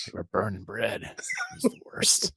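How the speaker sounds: hushed, breathy whisper. Pretend. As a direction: whisper it like a scary secret. Imagined friend gossiping about horror.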